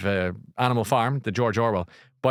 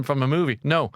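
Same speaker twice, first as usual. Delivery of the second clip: usual speaking voice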